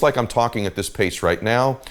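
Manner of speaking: slow, measured delivery; slow pace. Shown as a demonstration. Coach demonstrating a client's pace, slow and measured. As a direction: take it slowly and evenly. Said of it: "talk at a pace like this".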